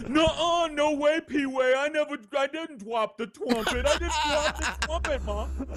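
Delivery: put-on high, laughing little-kid protest; whiny denying kid. Boy denying to his mom, high whiny kid voice. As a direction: squeak out the denial like a guilty boy. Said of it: "And Sean was like".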